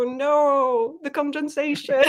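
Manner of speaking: high, hammed-up fake Australian; fake Australian accent. Shown as a demonstration. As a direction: put on an extra fake Australian accent, breaking into laughter. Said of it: "extra fake version of Cleo".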